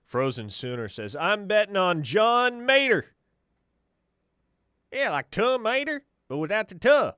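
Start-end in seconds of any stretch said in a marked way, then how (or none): 1.2-3.2 loud, high, drawn-out cartoon tow-truck voice; big, slow, high-pitched hollered impersonation; Larry the Cable Guy. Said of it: "That's the Larry the Cable Guy voice". 4.9-7.1 high, loud cartoon tow-truck impression; hammed-up, high-pitched comic impersonation; Larry the Cable Guy. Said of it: "That's the Larry the Cable Guy voice".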